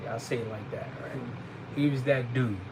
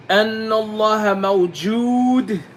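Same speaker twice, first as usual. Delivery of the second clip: recited Arabic; 5 semitones higher, 5 dB louder, much faster than usual. Shown as a demonstration. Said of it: "You have to know this".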